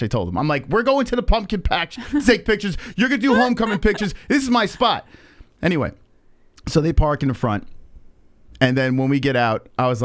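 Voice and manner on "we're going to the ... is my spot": higher-pitched indignant protest; retold indignation. Father telling the kid it's his spot, retold indignation. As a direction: argue your claim, re-enacting the outrage.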